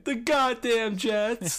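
put-on high, slow kid voice repeating a curse; high slow childish grumble; little kid voice. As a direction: say it like a clueless little boy. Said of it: "that's exactly what little kids do".

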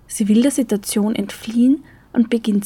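ordinary delivery, nothing marked

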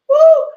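high, loud whoop; joyful woo. Real, not acted. Preacher whooping mid-blessing to her viewers. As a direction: burst out with a gleeful woo.

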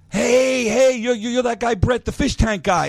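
a fan spotting a TV guy in a casino; imitating a high excited fan. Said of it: "he's like".

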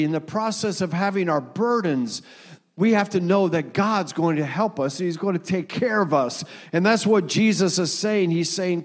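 usual speaking voice

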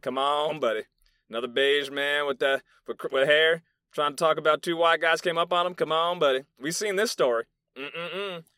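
imitating an incredulous doubter; dismissive sceptic. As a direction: act out a scoffing listener who won't buy it. Imagined sceptics dismissing his story.

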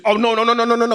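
mock protest. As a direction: imitate someone refusing to hear it. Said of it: "y'all can't be just".

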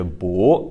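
"bu" with a rising second tone. Shown as a demonstration. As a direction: say "bu" rising, like a question. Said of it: "pronounced as a second tone".

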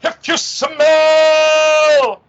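high, slow, drawn-out wrestler catchphrase; The Rock impression. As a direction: stretch it out like a wrestling promo. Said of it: "get in the mic ... and go".

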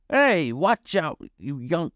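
high, indignant character voice; startled scolding uncle. A narrator's reading. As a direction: scold whoever bumped into you. Uncle bumped by someone scolds.

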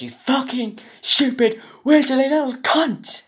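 slow, high-pitched mock furious yell; Gordon Ramsay. Podcaster as chef battering a kid, mock furious. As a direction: scream each word of the insult, hammed-up. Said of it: "get absolutely battered by Gordon Ramsay".

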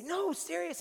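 high-pitched protest; earnest insistence. Acted. Disciples insist to Thomas.

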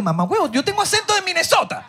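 friend's high mock comeback, laughing; high mock retort. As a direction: toss it off in a high joking voice. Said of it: "Yo le digo".

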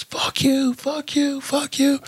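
high-pitched mock yell of a cursing driver; imitated angry yelling. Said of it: "all I hear is".

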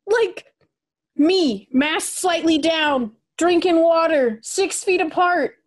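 high, rising disbelief into a shout; what am I supposed to do; incredulous. Retold or imitated. Incredulous retelling of insult.

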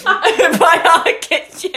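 slow, giggling self-mockery; laughing repeat. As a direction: stretch the words through giggles. Mock-repeating own slogan, laughing.

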